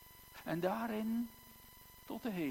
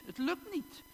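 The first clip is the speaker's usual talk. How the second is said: "het lukt niet" pushed much higher, rising on "niet"; anguished higher repeat; 13 semitones higher than usual. Acted. Preacher echoing the widow's plight, much higher and anguished. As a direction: say "niet" again, climbing much higher in distress. Widow cannot pay her debts.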